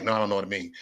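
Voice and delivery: low, soft deadpan innocence; playing dumb. Troll answering the man he fooled, playing dumb. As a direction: drop it quietly, feigning total ignorance. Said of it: "I play it like I don't know what it mean".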